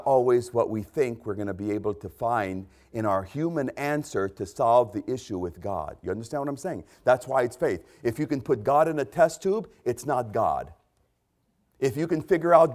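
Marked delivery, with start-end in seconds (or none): none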